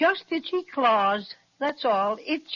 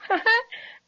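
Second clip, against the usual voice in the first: short high relieved laugh; 9 semitones higher than usual. Acted. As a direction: laugh off the fright. Laughs at the monster.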